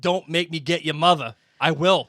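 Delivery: loud Boston accent, mock threatening; Boston father yelling a warning at the kids; hammed-up Boston accent, loud; Boston dad threat. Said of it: "Mister Weasley with a Boston accent".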